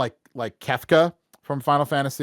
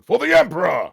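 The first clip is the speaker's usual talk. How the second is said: gruff battle cry; 7 semitones higher, 7 dB louder than usual. Shown as a demonstration. Voice actor quoting his character.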